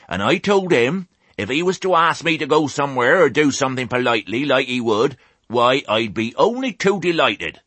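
high, rustic speech to the flock. A narrator's reading. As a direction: address the crowd as a country old ewe. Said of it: "Raising her voice".

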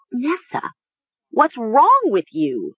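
high, alarmed and pleading; alarmed cry. A narrator's reading. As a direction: cry out in shock. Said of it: "Mara cried".

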